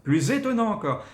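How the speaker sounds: very high, loud "Plus étonnant encore". Shown as a demonstration. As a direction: lift the tone sharply. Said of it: "Donc on monte, le ton".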